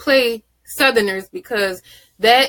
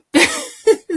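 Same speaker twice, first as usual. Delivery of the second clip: high laugh; 3 dB louder than usual. Real, not acted. Laughing at the scene.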